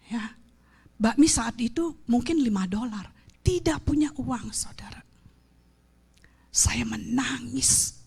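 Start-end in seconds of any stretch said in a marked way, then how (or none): 3.4-7.9 hushed confession. Retold or imitated. Preacher to congregation, recalling poverty, hushed whisper. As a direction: drop to a broken whisper.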